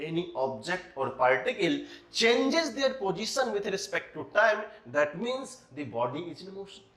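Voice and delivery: very slow, word by word definition; unhurried, deliberate read-out; slow dictation. Shown as a demonstration. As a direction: spell out the definition for note-taking. Teacher dictating definition of motion.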